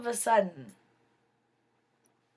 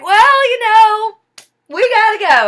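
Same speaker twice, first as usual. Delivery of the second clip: dismissive friend; 11 semitones higher, 12 dB louder than usual. Retold or imitated. Friend hurrying her out, mock hammed-up dismissive voice.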